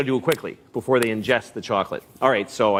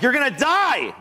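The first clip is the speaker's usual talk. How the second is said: a dog owner yelling in panic at the chocolate-eating dog; panicked yell; 13 semitones higher than usual. Retold or imitated. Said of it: "And I was like".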